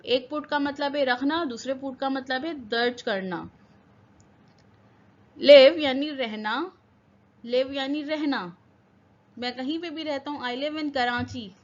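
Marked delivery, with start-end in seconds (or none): 5.4-6.6 लिव called out much louder; loud announcement. Shown as a demonstration. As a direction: announce लिव strongly to the class. Teacher announces new word loudly. (लिव ज़ोर से पुकारकर)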